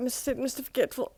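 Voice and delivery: ordinary delivery, nothing marked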